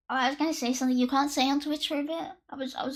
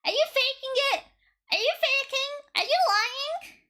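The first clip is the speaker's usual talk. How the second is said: mock survey voice; 9 semitones higher than usual. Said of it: "I'm not doing a survey going".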